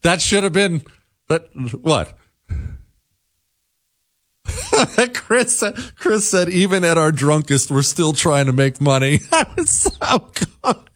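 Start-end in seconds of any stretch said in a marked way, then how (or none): 4.5-10.9 high, laughing retelling; real laughing. Real, not acted. Host relays Chris's joke to listeners, laughing. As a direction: crack up while passing on the joke.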